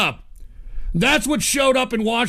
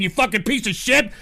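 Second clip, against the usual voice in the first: ordinary delivery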